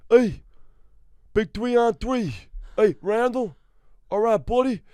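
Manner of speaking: high, loud goofy buddy voice; a comic calling his buddy in a put-on high voice; celebrity impression. Comedian greeting a buddy, high goofy impression.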